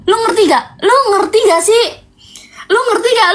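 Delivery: high, insistent repeat; exasperated. Real, not acted. To her live viewers, an exasperated high plea. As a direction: press the viewers to get it.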